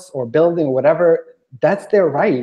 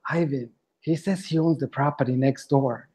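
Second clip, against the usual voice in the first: muttering to himself; 7 dB quieter than usual. Retold or imitated. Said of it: "I was walking away and like".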